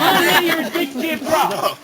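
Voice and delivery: slow, deliberate Newfoundland toast; drawn-out teaching delivery; slow demo. Shown as a demonstration. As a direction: spell it out slowly for the learner. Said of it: "I'll try it now".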